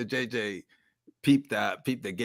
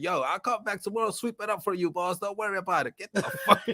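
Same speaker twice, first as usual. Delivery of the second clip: high, breezy mock apology; carefree delivery kid; 9 semitones higher than usual. Storyteller voicing the pizza kid apologizing to the golfers. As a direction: toss off a cheeky no-worries apology. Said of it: "He was like".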